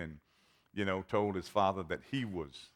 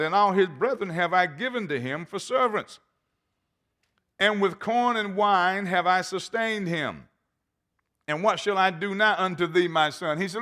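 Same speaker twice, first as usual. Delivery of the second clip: high, loud, hammering sermon voice; full-force preaching; 8 semitones higher, 7 dB louder than usual. Acted. Preacher driving the point home to his church, fervent. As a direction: preach it to the back row.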